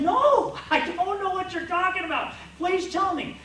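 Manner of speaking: near-hysterical plea to be told; desperate pleading. Retold or imitated. Husband begging wife who points at cabinet, frantic and high.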